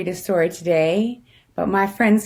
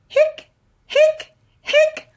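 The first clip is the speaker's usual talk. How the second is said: high squeaky hic hic hic; squeaky hiccups; slower than usual. Acted. Skeleton hiccuping to the kids, high squeaky hics.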